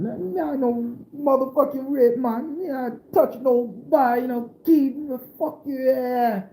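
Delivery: very high, outraged mock Jamaican voice; Jamaican man protesting. Jamaican man protesting Grandma's accusation, very high and outraged. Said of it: "Jamaican man protesting".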